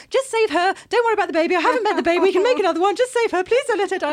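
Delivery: frantic high loud begging, voice up high; desperate pleading. Retold or imitated. Husband begging doctors to save his wife, frantic loud plea. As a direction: beg them to save her, panicked. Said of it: "he was just, like, saying".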